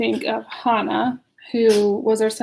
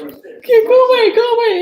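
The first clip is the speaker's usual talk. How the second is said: laughing; 11 semitones higher, 7 dB louder than usual. Real, not acted. Shooing husband while laughing.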